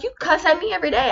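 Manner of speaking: high, quick accusing voice; squeaky-high retort in an acted spat; mock complaint. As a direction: imitate a boyfriend griping back in a high pitch. Boyfriend's complaint in acted quarrel.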